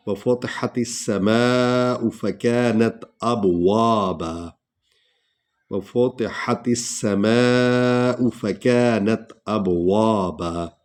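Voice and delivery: slow, melodic tajweed recitation; Quran recitation. Acted. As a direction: recite the verse with measured, drawn vowels. Recites verse slowly. (धीमी क़ुरआनी तिलावत)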